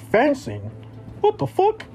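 high-pitched, baffled disbelief; incredulous mimicry. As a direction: mimic scoffers, pitch leaping up. Mimics people scoffing at fencing.

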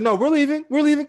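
very high, loud protest; hammed-up, high-pitched repeated promise; mock frantic insistence. Movers stuck with a dead truck, frantic mock insistence.

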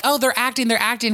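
high urgent retold tip-off; lightly acted, high-pitched insistence; mock insistence. Retelling him alerting the officer, high mock insistence.

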